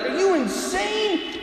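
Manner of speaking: high, slow, disbelieving cry; panicked kids. Acted. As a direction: plead with the crazy coach. Kids locked in with snakes.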